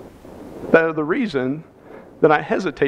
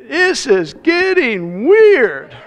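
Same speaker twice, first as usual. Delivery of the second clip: slow, high "this is getting weird"; drawn-out disbelief; 8 semitones higher, 4 dB louder, much slower than usual. Retold or imitated. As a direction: stretch it out, incredulous. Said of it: "I'm thinking".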